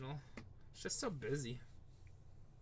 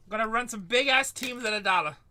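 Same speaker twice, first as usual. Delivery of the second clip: louder, higher sales pitch; hyped announcement; 5 semitones higher, 7 dB louder than usual. Real, not acted. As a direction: sell the deal. Announcing dollar auctions.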